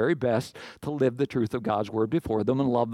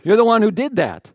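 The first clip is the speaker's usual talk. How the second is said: loud, higher accusing cry; prophet's accusation; 7 semitones higher, 7 dB louder than usual. Retold or imitated. Prophet Nathan confronts King David, loud and accusing. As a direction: point at the king and call him out. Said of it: "He said".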